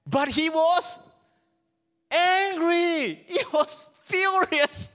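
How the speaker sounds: acted fury. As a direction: lean into his rage. Reading the son's anger.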